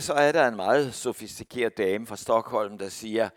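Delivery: ordinary talk, nothing marked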